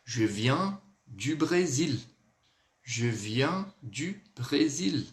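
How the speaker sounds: slow, word-by-word French for learners; slow model answer. Shown as a demonstration. Teacher answering that she is from Brazil, slow careful model. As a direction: model each word carefully at half pace. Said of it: "vamos lá, vou responder".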